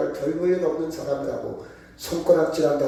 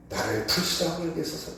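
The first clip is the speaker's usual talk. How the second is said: humble whispered prayer; earnest plea; 6 dB quieter than usual. Acted. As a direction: plead quietly from your knees.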